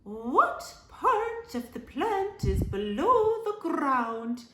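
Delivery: high, slow teacher voice; high singsong teacher. Acted. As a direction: quiz the class slowly. Teacher asks question.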